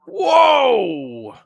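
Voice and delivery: sudden high shocked whoa; surprised commentator cry; startled whoa. Real, not acted. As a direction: yelp at the crash. Commentator sees car crash.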